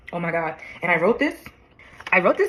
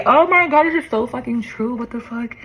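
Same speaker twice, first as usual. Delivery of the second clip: stunned disbelief; 6 semitones higher than usual. Real, not acted. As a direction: blurt it out, floored by how true it is. Stunned by her letter's accuracy.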